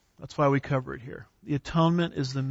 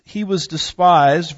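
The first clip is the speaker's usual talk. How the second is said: slow, louder, leaning on despised; emphatic scripture reading; 2 semitones higher, 7 dB louder, slower than usual. Shown as a demonstration. Teacher reading Isaiah to the class, slow and emphatic. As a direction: press down hard on despised as you read it. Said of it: "from the way I emphasize some of those".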